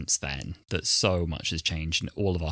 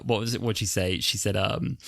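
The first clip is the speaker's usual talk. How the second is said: ordinary talk, nothing marked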